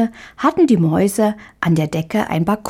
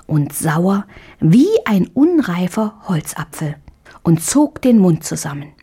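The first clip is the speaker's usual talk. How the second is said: ordinary delivery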